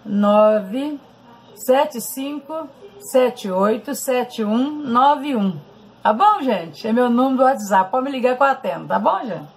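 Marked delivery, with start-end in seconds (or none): 0.0-5.6 digits spelled out slowly one by one; slow dictation. Shown as a demonstration. Giving viewers her WhatsApp number, slow careful dictation. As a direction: read the number slowly so they can write it. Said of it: "vou falar aqui, ó".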